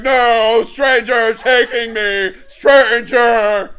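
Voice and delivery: loud, slow alarmed yell; kid yelling stranger. Retold or imitated. Kid warning onlookers about a kidnapper, loud alarmed yell. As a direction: call out the stranger so people turn. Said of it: "And they're like".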